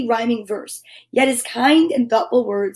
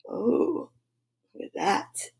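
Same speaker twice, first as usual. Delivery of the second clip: whispered aside; 10 dB quieter, slower than usual. Real, not acted. Aside while showing picture.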